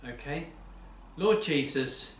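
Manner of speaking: ordinary talk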